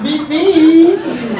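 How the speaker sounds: laughing through the joke; words breaking into real laughter. Real, not acted. Joking to the audience about divorce work, laughing.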